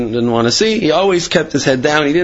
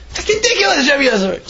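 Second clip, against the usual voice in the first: laughing; 12 semitones higher than usual. Real, not acted. Speaker laughs at the quote.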